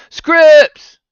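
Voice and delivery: sudden loud yell of a name; a burst of real excitement; excited shout. Real, not acted. Viewer Scripps just joined chat.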